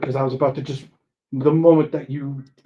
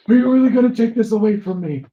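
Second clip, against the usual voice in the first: playful pleading outrage; mock whiny protest; 8 semitones higher, 6 dB louder than usual. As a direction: whine it playfully.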